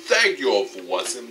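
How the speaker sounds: ordinary talk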